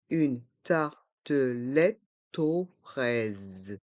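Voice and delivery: slow "Une tartelette aux fraises", every syllable apart; learner-paced, split "Une tartelette aux fraises". Shown as a demonstration. Teacher breaks phrase into syllables.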